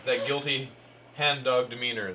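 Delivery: ordinary talk, nothing marked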